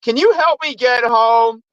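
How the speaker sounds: high, drawn-out mock yell; retold yell. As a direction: cry for help, hammed up but not full volume. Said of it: "on the sidewalk yelling".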